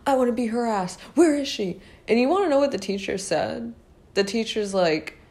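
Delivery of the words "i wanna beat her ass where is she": high-pitched imitation of a raging yell; hammed-up high furious yell; mock screaming. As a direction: shriek it like a bully hunting someone. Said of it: "She runs and she's like".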